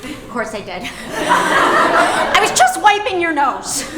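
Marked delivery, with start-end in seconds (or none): 1.1-3.9 higher, slow, stretched self-defence; exasperated protest. Acted. Mother defending herself to crying daughter, exasperated protest. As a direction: insist on innocence to a wailing child.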